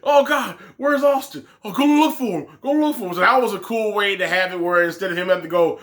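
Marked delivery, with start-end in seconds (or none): 0.0-3.1 higher, panicky retelling of a heel; lightly hammed-up frantic heel; mock panicked wrestler. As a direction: play a flustered heel calling for his partner. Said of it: "then he's like".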